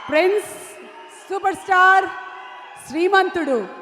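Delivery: loud, stretched-out stage introduction; hype announcer. Real, not acted. Host announcing the film star's arrival, rousing announcer shout. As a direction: belt out each title with long held vowels.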